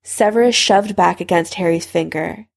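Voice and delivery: ordinary delivery, nothing marked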